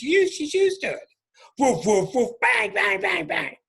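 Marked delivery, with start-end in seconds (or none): 1.6-3.6 imitated barks and bangs. Imitating barking and banging again.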